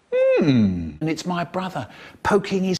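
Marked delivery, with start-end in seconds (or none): 0.1-1.0 odd, loud hum in a funny voice. Retold or imitated. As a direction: hum it out as a silly noise. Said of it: "I hear a funny voice going".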